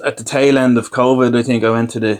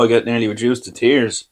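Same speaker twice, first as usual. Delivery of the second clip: ordinary delivery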